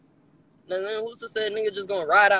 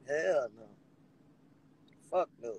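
ordinary talk

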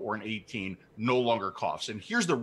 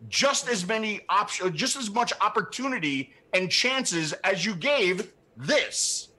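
higher, insistent pleading rant; heated demand; 7 semitones higher than usual. Real, not acted. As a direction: demand it, worked up. Ranting demand to regulators.

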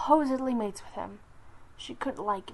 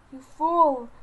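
loud angry snarl; growling; 4 semitones higher, 10 dB louder than usual. Acted. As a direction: snap at her furiously. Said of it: "growled again".

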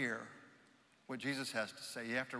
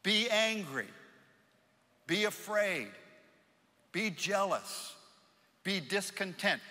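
raised pitch, separate commands with pauses; mock crowd shouting; 5 semitones higher than usual. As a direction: call out each order as the pushy crowd. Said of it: "That's being shouted at us".

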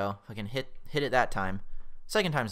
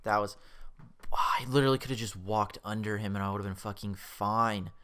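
normal talking voice

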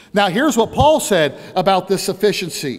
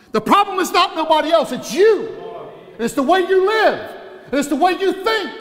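preaching at full force; 5 semitones higher than usual. Acted. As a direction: drive it home from the pulpit. Preacher building to a climax.

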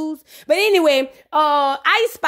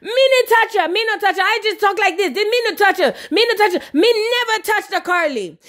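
high-pitched mock Jamaican protest; imitated patois, higher and indignant; 4 semitones higher than usual. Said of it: "And [name] said".

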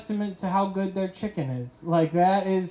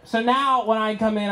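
ordinary talk

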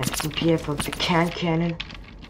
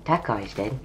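ordinary talk

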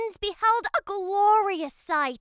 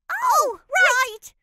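realizing; 7 semitones higher, 7 dB louder than usual. Acted. Twins suddenly recall.

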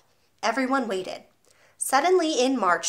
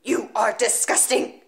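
1 slow disgusted bellow; slower than usual. Acted. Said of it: "the Trunchbull bellowed".

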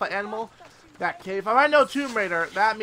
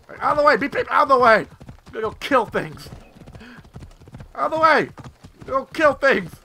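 loud, higher charge; yelling out of the way; 5 semitones higher, 6 dB louder than usual. Real, not acted. Rider yells at people to clear the way. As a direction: bellow as you ride through.

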